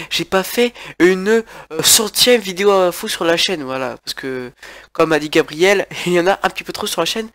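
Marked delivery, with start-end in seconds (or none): none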